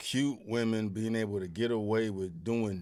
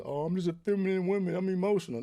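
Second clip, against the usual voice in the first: higher mock voice of a whiny woman; 8 semitones higher than usual. A man mimics a woman excusing herself. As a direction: sneer it out as a mock girlfriend. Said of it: "women talking about this".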